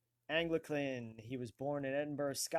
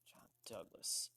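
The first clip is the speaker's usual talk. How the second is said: whisper; 5 semitones lower, 9 dB quieter than usual. Real, not acted. Muttering name while searching.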